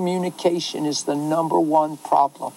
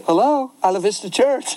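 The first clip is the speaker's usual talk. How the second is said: higher, sing-out receptionist greeting; put-on phone voice; 5 semitones higher than usual. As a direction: answer the church phone as if all is fine. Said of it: "I put on a voice".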